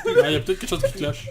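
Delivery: squeaky laughing joke. Real, not acted. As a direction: laugh through it, high pitched. Jokes, laughing high.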